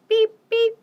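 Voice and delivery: high, loud toy horn beep; squeaky horn beep. Acted. Teacher reading the refrain, loud squeaky horn beep.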